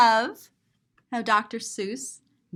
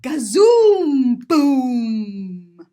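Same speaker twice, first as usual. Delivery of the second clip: very slow, loud booming gazoom; drawn-out loud cannon call; big boom; 9 dB louder, much slower than usual. Acted.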